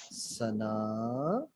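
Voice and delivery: सना drawn out very slow and low; command intonation. Shown as a demonstration. Trainer demonstrating a command, सना long and low. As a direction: stretch सना, holding it down low. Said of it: "आवाज़ मेरी नीचे को गई".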